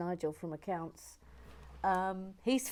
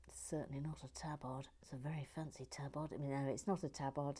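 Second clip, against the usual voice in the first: whispered aside; 5 semitones lower, 11 dB quieter, faster than usual. Real, not acted. Whispered aside about the outfit.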